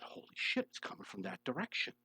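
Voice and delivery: hushed, breathless whisper of discovery; stunned whispered realization; retold whisper. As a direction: whisper it like you just caught something.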